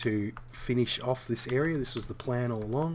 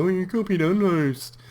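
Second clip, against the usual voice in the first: imitating a critic; 8 semitones higher, 8 dB louder than usual. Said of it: "people are gonna see this and go".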